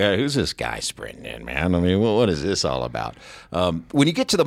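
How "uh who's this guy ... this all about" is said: puzzled opponent. Retold or imitated. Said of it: "you're like".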